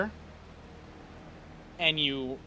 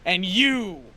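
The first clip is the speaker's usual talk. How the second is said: father shouting scorn at his son; furious shout; 3 semitones higher, slower than usual. Acted. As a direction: bellow his contempt down at the son. Villain father rages at son.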